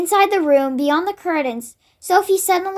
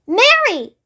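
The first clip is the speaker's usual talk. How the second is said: a sharp call, higher and louder; 7 semitones higher, 4 dB louder than usual. Acted. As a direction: snap her name out to bring her round. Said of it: "rather sharply".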